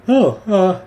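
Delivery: high, loud dog voice; goofy dog sputtering. Acted. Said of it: "He goes".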